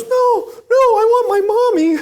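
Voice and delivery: put-on high, loud whiny child voice; little boy's plea. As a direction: whine it like a toddler clinging to mom. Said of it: "and he goes".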